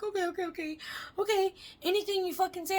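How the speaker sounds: high, rushed frightened okays; imitating her scared self agreeing; scared compliance. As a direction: babble agreement out of fear. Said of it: "I'm like".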